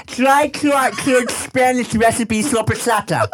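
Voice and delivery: high mock Spanish lisp; badly faked lisping Spain accent. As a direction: read it out with a slushy Spanish lisp. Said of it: "That's not in the right list".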